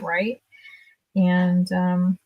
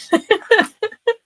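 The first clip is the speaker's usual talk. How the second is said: real laugh jumping way up; real squeal of laughter; high laugh; 13 semitones higher, 5 dB louder than usual. Real, not acted. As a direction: burst into a high, loud laugh. Laughing about drive-through job.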